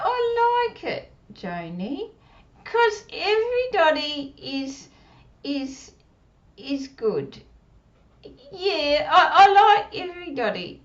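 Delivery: put-on high, squeaky little puppet girl; puppet child voice. Puppet Susie, [name]'s ventriloquist doll.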